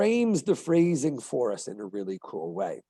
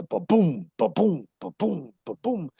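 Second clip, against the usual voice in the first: ba-BOOM, each boom punched and falling; short ba, strong BOOM, like a heartbeat; iambic beat; 4 semitones higher than usual. Shown as a demonstration. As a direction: pound out "ba-boom" in separate pulses, stress on boom. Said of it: "to put the beat on words".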